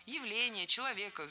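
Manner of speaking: usual speaking voice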